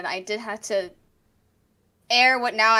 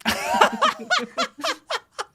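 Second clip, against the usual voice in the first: Japanese villain laugh. Shown as a demonstration. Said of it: "that's my Japanese villain laugh".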